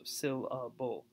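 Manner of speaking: "syllable" broken slowly into syl-la-ble. Shown as a demonstration. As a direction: say "syllable" slowly, one unit at a time. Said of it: "a syllable is that one unit of sound".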